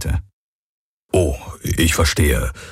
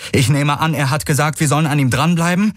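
much higher, quick call over the gallop; 9 semitones higher than usual. A narrator's reading. Kirai calling to Garland during the chase, high hurried call. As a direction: shout the question across while riding. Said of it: "rief Kirai".

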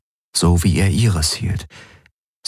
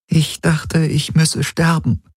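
raised, wavering voice near tears; fighting back tears as she remembers; tearful; 11 semitones higher, slower than usual. A narrator's reading. Ylana confides her loneliness to Galen, close to tears. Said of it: "Ylanas Augen glitzerten feucht".

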